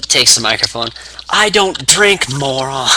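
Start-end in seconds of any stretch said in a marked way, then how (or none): 1.3-2.9 high, slow, emphatic mock retort; CM Punk. Wrestler on the mic refusing a beer, mock scornful. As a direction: put down a rival, drawing the words out. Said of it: "C M Punk is just, he takes the microphone".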